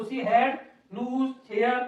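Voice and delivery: very slow, drawn-out words; slow dictation. Shown as a demonstration. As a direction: dictate to students copying. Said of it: "teacher dictates while writing". (धीरे-धीरे लिखवाते हुए)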